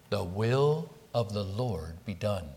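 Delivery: "The will of the Lord be done" said soft and slow, quiet reverent submission; sincere surrender. Shown as a demonstration. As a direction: yield humbly to God's will, softly and slowly. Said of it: "This is not just a throwing up of the hands".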